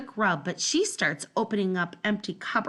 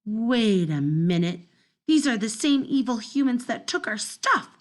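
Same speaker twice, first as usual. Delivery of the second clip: usual speaking voice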